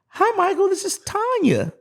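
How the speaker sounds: very high mock caller voice, slowing on the name; high-pitched phone impression; woman caller.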